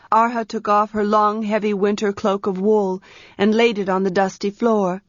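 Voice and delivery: usual speaking voice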